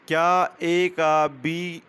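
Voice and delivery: usual speaking voice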